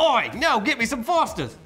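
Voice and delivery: put-on high, loud Australian accent. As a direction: demand it in a hammy Australian accent. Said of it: "An Australian expat ... was like".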